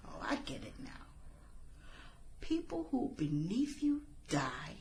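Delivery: soft, breathy whisper of realization; chilling whisper. Acted. Killer sneers back at her rival in a whisper. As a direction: hush it like a cold revelation.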